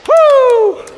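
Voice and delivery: very high, loud victory whoop; excited whoop. Real, not acted. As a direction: whoop at the finish line. Finish line cheer.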